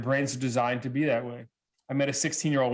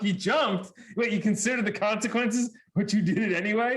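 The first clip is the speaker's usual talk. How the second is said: higher-pitched retold disbelief; incredulous; 6 semitones higher than usual. Adult questions teen who jumped off the cliff, incredulous. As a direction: question it in mock astonishment.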